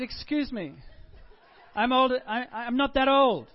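loud, high mock-offended protest; indignant protest. Pastor retelling his protest to the shop clerk, loud and indignant. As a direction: bristle at being called old. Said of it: "I declared".

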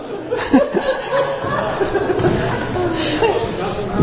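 high, long laugh. Real, not acted. Preacher laughing at his own joke. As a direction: crack up at your own joke.

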